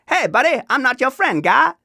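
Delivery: very high, loud cartoon Canadian voice; South Park Canadians. Host slipping into a cartoon impression, high and loud Canadian accent. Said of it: "Canadians from South Park".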